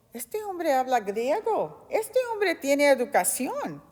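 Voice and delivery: astonished, with big pitch swings; surprised. Retold or imitated. Preacher voices the Roman commander amazed at Paul, surprised. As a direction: marvel at it in disbelief. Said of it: "se quedó sorprendido porque dijo".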